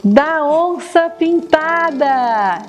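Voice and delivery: put-on slow, bright toddler voice; reading to a toddler. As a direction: stretch it out for little kids. Animal sound answer.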